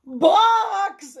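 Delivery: high, loud outburst; one drawn-out, high-pitched cry; shocked exclamation. A narrator's reading. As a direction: exclaim it in astonishment. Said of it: "exclaimed Missus Rogers".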